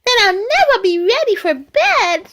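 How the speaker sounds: high-pitched little bunny voice. Acted. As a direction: pipe up high like a wide-awake bunny child. Voicing lively little bunny in story.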